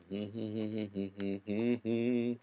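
quiet self-conscious chuckle; soft laugh. Real, not acted. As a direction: laugh at yourself softly. Laughs at own singing.

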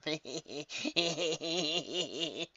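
high, cackling villain laugh; sick evil laugh. A narrator's reading. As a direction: laugh with cruel glee. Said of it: "She laughed".

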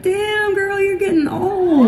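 high-pitched, drawn-out mock teasing; teasing friends. Friends teasing at a birthday, high mock hoot. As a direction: imitate friends hooting in a high voice.

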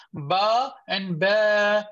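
Ba and ba very slow, heavy then smiling light. Shown as a demonstration. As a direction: contrast full ba, then light ba. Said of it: "can you hear the difference".

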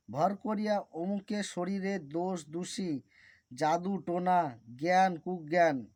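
a bit speedier, flowing chant. Shown as a demonstration. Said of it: "এইবার মন্ত্রটি একটু স্পিডেই বলবো".

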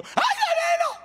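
very high, loud mock lament for Danilo; hired mourner. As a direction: ham up a paid wailer crying over a stranger. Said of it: "le pagan a lloronas también".

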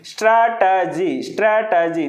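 స్ట్రాటజీ in three drawn-out beats, స్ట్రా-ట-జీ; syllable-by-syllable demo. Shown as a demonstration. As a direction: sound out each syllable of స్ట్రాటజీ. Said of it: "three syllables ఉంటాయి". (స్ట్రాటజీని స్ట్రా-ట-జీ అని విడదీసి పలకడం)

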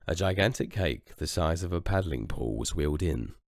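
usual speaking voice

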